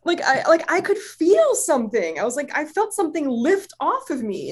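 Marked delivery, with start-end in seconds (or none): 0.7-2.2 high, stretched-out wonder; amazed. Retold or imitated. Client telling healer what she felt, amazed. 2.6-4.5 high-pitched voice of wonder; amazed. Retold or imitated. Client describing sensation to healer, amazed.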